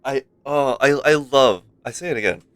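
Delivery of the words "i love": ordinary delivery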